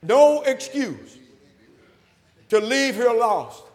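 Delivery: loud, high-pitched sermon delivery; full-force preaching. Real, not acted. Preacher urging the lost to be baptized, full-force preaching. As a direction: preach it out to the whole church.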